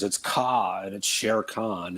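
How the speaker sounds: usual speaking voice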